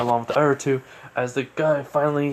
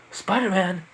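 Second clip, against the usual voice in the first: name repeated higher as a question; mildly incredulous, pitched-up query; puzzled echo; 6 semitones higher, slower than usual. Acted. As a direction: echo it back in disbelief. Robber repeats the name back.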